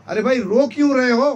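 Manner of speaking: loud, quick, high-pitched questioning; exasperated question. Acted. Bandit to the weeping eater, exasperated. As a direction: demand to know why, raising pitch sharply. Bandit asks why he cries.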